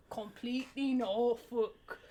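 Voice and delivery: put-on slow, drawn-out Norfolk accent; stretched mock Norfolk accent; imitated Norfolk accent. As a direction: lightly mimic a Norfolk speaker. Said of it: "consider your accent".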